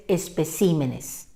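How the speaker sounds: "especímenes" stressed on CI, es-pe-CI-me-nes; "especímenes" in its correct plural form. Shown as a demonstration. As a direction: put the weight of "especímenes" on the I. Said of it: "el plural sí tiene su fuerza en la I".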